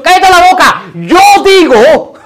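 high, loud mock rebuke with a drawn-out ending; Jesús. Jesus silencing the devil, loud commanding rebuke. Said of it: "Jesús podría haber dicho".

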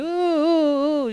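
high, drawn-out whining howl; long mock canine wail; imitated dog howl. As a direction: imitate a pitiful crying dog. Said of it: "ahí llorando".

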